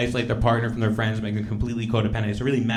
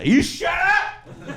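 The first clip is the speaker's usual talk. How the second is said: high, drawn-out mock yell; much slower than usual. Mom yells at dad, high, slow and laughing. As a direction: screech it like a tipsy mom. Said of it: "And she goes".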